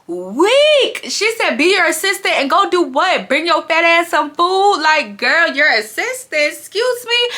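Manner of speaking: high giddy retelling; high excited. Real, not acted. Vlogger retelling Slim's insult, giddy and high. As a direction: squeal it up high, still laughing.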